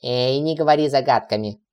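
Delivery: insistent demand. A narrator's reading. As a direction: press for an answer. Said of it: "потребовал Цикламон".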